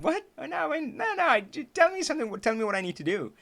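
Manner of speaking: high-pitched, stumbling mock disbelief; acted baffled protest. Student begging coach for direction, baffled protest. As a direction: plead for instructions, pitch shooting up.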